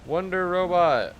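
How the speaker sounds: booming, slow mock announcement; mock announcer. Streamer announcing a strange title, booming and slow. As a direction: boom out the title like a game show host. Reading odd game title aloud.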